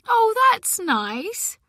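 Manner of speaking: lifts high and bright, drawn out slowly; light up at the news of the baby; mother delighted, brightening as her granddaughter is mentioned. A narrator's reading. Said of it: "her voice brightening at the mention of Evie".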